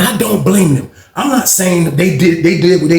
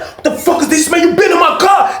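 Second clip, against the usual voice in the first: angry shout; 13 semitones higher than usual. Retold or imitated. Angry player yells at the card cheat, shouting high. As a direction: rage at the cheat.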